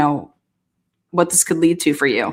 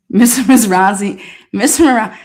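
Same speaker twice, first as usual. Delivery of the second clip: louder repeated call; 3 semitones higher, 4 dB louder than usual. Acted. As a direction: keep calling his name, punchy. Calling out to lawyer on screen.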